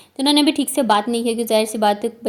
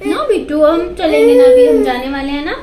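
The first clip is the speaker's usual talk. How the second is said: higher, louder soothing over the wails; soothing over crying; 10 semitones higher, 5 dB louder than usual. Real, not acted. Mother to her wailing little one, raised and soothing.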